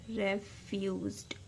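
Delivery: रिफ्यूज्ड drawn out slowly and softly, ending zd; slow soft demo word. Shown as a demonstration. Teacher slowly sounding out a word, soft and drawn out. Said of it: "जेड डी की sound आ रही है".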